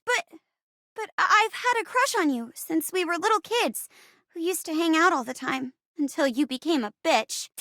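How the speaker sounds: put-on high, halting teenage crush confession; nerdy girl, flustered confession. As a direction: stammer into it, high and earnest. Plays Sky, nerdy girl confessing.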